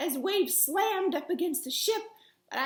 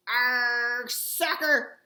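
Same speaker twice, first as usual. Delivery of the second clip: loud gruff pirate chorus; rowdy crew echoing the captain; crew's pirate yell; 3 semitones lower, 5 dB louder, much slower than usual. Acted. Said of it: "the crew yelled".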